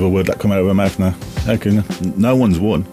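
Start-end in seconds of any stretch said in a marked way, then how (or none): none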